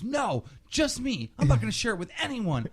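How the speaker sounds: high, squeaky mocking voice of the greedy kid; mock whiny kid. YouTuber mocking the kid who tried to buy the trick, high and whiny.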